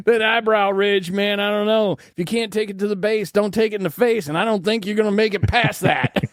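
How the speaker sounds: high hillbilly voice; hillbilly accent. Pretend. Hillbilly persona rhyme.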